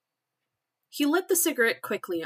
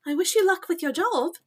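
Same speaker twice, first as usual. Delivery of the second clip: high posh British lady; posh British, pitched way up; posh British accent; 9 semitones higher, 4 dB louder than usual. Acted. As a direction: wish it in a lofty posh British voice. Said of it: "the fucking posh British accent".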